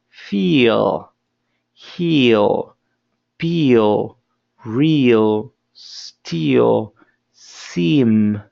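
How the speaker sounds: feel, heal, peel said with a long smiling ee; long E demo. Shown as a demonstration. Teacher drilling the long E for the class, slow and smiling. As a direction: stretch the ee in feel like a smile. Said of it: "como si tuvieras una sonrisa".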